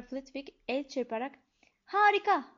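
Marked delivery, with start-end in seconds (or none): 1.8-2.4 high, loud burst of joy; excited little professor cheering; delighted shout. A narrator's reading. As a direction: cry out in delight while clapping. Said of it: "diye bağırdı".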